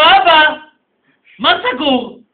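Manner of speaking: high, loud cry of Dad; calling out. Acted. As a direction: son calls out to the father he hasn't seen, loud and high. Son calling out to his father.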